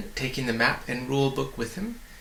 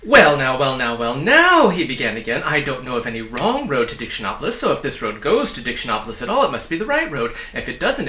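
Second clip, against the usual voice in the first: fast excited little man; 6 dB louder than usual. Acted. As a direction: bubble over with welcome, words tumbling. The weatherman voice continues.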